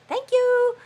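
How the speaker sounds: high, loud thank you; mock exasperated thanks. Real, not acted. Host thanks viewer for writing in Greek, mock exasperated. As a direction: tease the viewer.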